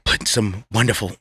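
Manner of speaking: low, barely voiced mock-gourmet murmur; hushed chef impression. Comic mocking a TV chef's ingredient talk, hushed. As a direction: imitate a chef half-whispering over his ingredients. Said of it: "he starts whispering his stuff".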